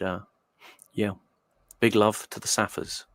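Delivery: usual speaking voice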